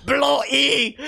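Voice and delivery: high, loud mock-British yell; re-enacted British scream. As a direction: yell it like a botched job just happened. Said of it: "I used to scream that".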